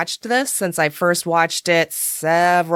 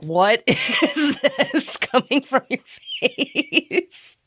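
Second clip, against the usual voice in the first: higher, very slow, giggling through it; stunned by a weird accent; laughing disbelief; 7 semitones higher, much slower than usual. Retold or imitated. Mock bafflement at accent.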